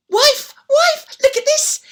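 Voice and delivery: high excited shout; excited calling. Acted. Old man shouting to wife about the shoes. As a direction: call her over in delight.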